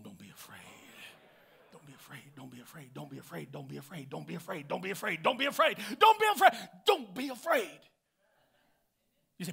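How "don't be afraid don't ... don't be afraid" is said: repeated "Don't be afraid." building from hushed to a loud shout; bad to the bone. Shown as a demonstration. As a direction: start in a whisper and build to a defiant roar. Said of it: "Say it like you're bad to the bone".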